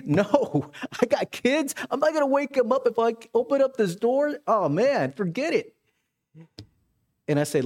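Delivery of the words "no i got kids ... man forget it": higher put-on voice of the neighbor refusing; Leo refusing. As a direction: play the grumpy neighbor saying no, with a chuckle. Neighbor Leo refuses through door.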